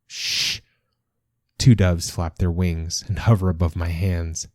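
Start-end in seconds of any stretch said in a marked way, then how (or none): 0.1-0.6 soft, voiceless shush; hushing. A narrator's reading. Someone silences the grumbling comrades, hushing. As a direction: hush them with a breath.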